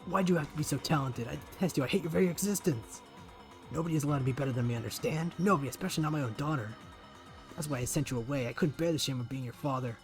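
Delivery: loud, fast, hateful screaming father; hateful rant. Acted. Father strangling daughter while screaming his hatred, loud and fast. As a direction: pour out jealous hatred.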